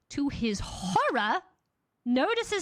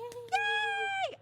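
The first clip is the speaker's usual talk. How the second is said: very high squeaky yay; squealed baby cheer. Acted. Narrator voicing the babies' cheer, squealed very high.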